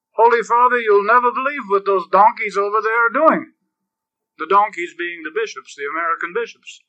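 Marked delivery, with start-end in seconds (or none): 0.1-3.5 higher and louder, eager tattling to the Pope; a priest bursting to tell the Holy Father, higher and louder; excited priest reporting. Retold or imitated. Preacher voicing a priest tattling to the Pope, higher and louder. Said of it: "And he said".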